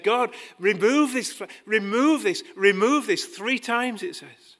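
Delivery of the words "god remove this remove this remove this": loud, high, pleading cry; crying out to God. Retold or imitated. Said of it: "he cried out to God".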